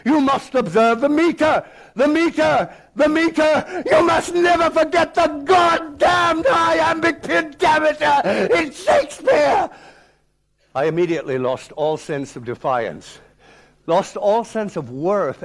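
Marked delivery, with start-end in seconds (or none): 0.0-9.7 very high, loud, hammering the meter; raging scream. Retold or imitated. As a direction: bellow the rule like a mad dog. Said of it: "he began to scream".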